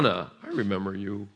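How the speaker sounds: ordinary delivery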